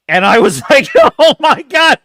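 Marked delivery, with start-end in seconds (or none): 1.2-2.0 loud high oh my God; excited. Retold or imitated. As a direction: shout it in delight. Laughing disbelief at uncle.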